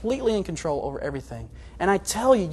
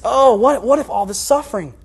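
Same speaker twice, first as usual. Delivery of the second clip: fearful fretting; 5 semitones higher, 7 dB louder than usual. Acted. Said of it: "And I fear".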